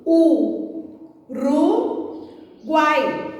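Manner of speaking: U, Ru, Guai as three separate beats; syllable by syllable. Shown as a demonstration. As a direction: pause after each: U... Ru... Guai. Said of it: "Vamos separar? Fica assim".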